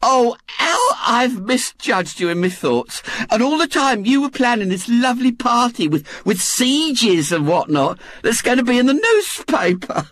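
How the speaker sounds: high, gushing woman's voice; Missus Bott, fervent. A narrator's reading. Missus Bott apologises to William for misjudging him, fervent. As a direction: pour out delighted apology.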